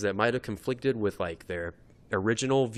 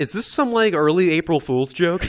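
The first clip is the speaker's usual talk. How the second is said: higher, louder put-on questioner voice; imitating audience questioner; 6 semitones higher, 7 dB louder than usual. Guy at the microphone challenging game announcers, imitated. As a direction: pitch it up like an incredulous audience member. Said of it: "a guy came up to the microphone".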